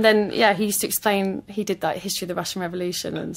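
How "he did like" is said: ordinary talk, nothing marked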